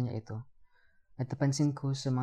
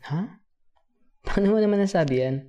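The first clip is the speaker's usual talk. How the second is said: higher, surprised questioning; startled, louder comeback; taken aback; 8 semitones higher, 8 dB louder than usual. Acted. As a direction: react as if caught off guard by a child. Caught off guard by question.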